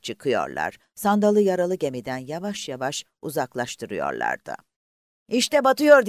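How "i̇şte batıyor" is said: high, loud cry of alarm; alarmed shout. Acted. A man in the boat crying out as the ship sinks, alarmed. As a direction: yell it as the ship goes down. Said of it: "diye bağırdı biri".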